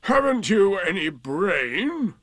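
slow, high-swooping scorn; scornful donkey. A narrator's reading. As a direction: draw the question out witheringly. Eeyore scorns Rabbit.

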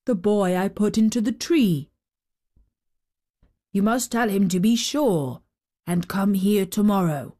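lightly voiced storybook giant; a hint of a giant's voice. A narrator's reading. Giant sending word to the boy, lightly voiced.

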